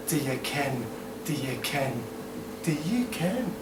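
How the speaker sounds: quiet Scottish echo; Scottish accent. Acted. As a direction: softly repeat it in put-on Scots. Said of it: "do the Scottish accents".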